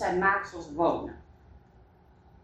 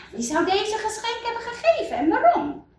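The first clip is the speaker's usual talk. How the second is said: much higher, curious question; wondering villager; 11 semitones higher than usual. Acted. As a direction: ask it wide-eyed and high. Villagers wonder who gave gifts. (veel hoger, nieuwsgierig vragend)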